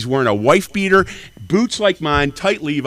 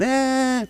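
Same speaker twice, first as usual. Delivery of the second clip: mocking high squeak; 13 semitones higher, 5 dB quieter than usual. Mocking noise.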